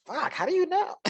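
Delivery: high-pitched startled reply; retold astonishment. Student startled that teacher guessed Texas, astonished. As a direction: blurt it in amused disbelief.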